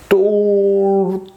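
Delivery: long, high, drawn-out turtle; slow motion. Shown as a demonstration. Teacher reviewing the slow animal, slow motion. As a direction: stretch TURTLE out very slowly.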